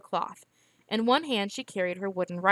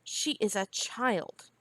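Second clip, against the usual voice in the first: stiff, softer and slow; cold, clipped dismissal, quietly; 6 dB quieter, slower than usual. A narrator's reading. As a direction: stiff reply to end the argument, softer. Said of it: "stiffly turning away".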